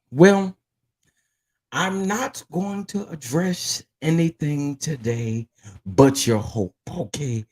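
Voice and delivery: slow churchy sermon opening; preacher lady voice. Acted. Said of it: "put on my preacher lady voice".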